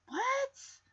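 high, hushed "what?"; a soft squeak of feigned shock; mock disbelief. Mock disbelief at plot inconsistency.

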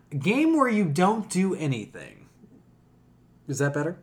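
normal talking voice

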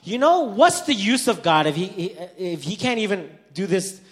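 loud, high outburst, imitating a child; acted childish outburst at high volume; sudden blurting. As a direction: blurt it out like a frustrated kid. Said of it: "all of a sudden he blurts out".

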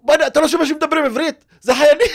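high, loud, fast disbelief breaking into a laugh; exasperated friend. Retold or imitated. Driver friend scolding the panicking narrator, high, loud and laughing. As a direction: imitate a friend who can't believe you missed it. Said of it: "ואסף הסתכל עליי, כאילו".

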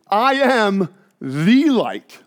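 very slow, with heavy weight on "the"; accentuated claim. Shown as a demonstration. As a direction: draw out "I am" and "the", punching "the". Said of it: "just so I can accentuate it".

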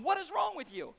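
high, incredulous mock question; puzzled onlooker. People watching a calm believer, mock bewildered high question. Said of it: "they're going".

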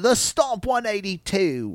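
high, slow announcer impression; Nigel. Co-host quoting the commentator's name for the move, high, slow impression. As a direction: draw it out in a high put-on commentator voice. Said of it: "at least that's what Nigel said".

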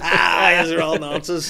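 high, loud hammed-up telling-off; mock outraged yell. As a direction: shout it like a furious killjoy. Repeats the imagined scolding louder.

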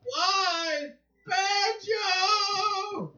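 high, loud, slow mock wail ending in a sung Banjo; wailing cartoon lament. Imitating a cartoon lament to a friend, high wailing voice. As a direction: wail it like a heartbroken cartoon character.

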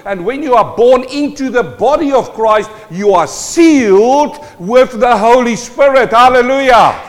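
loud, high, rousing sermon delivery; impassioned pulpit proclamation; full-force preaching. Real, not acted. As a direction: preach it out with mounting force to a hallelujah.

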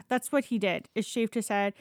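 ordinary talk, nothing marked

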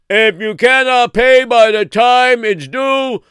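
high, loud proclamation shout; town crier. Acted. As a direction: boom the decree out like a town crier. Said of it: "And they would call out".

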